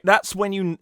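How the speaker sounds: normal talking voice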